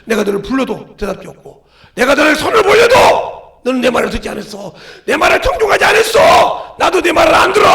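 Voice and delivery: building to a loud, high shout; preacher voicing an angry God, swelling to full force; the Lord's rebuke. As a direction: thunder it out as God rejecting the sinner. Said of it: "그러니까 우리 주님께서".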